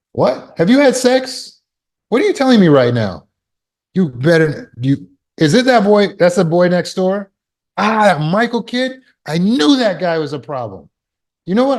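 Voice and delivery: high, loud panicked yelling at daughter; overreacting dad. Shown as a demonstration. Father yells at daughter over her question, high and loud. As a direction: blow up in alarm, voice climbing high. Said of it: "See the problem here?".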